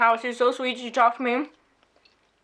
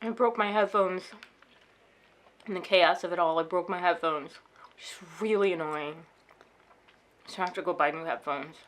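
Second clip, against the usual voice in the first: much softer, lower, drained; barely above a whisper, shaken; 6 semitones lower, 9 dB quieter than usual. Real, not acted. After the robbery, telling viewers she feels weak, shaken and hushed. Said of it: "I feel weak".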